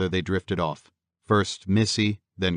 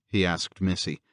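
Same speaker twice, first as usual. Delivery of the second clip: ordinary delivery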